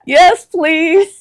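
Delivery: eager yes. Retold or imitated. Mother eagerly accepts the elephant ride.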